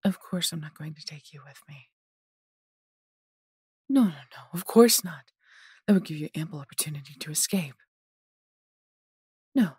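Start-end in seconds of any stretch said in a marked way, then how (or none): 3.9-5.3 loud, raised dismissal; louder, higher flurry of noes, then a firm denial; emphatic refusal. Acted. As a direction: shoot the idea down firmly. Captor emphatically rejects taking captive along.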